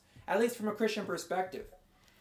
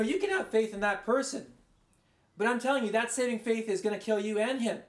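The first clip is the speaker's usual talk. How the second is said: normal talking voice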